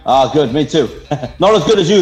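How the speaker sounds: ordinary talk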